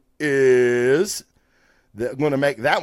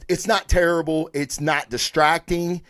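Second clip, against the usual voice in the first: normal talking voice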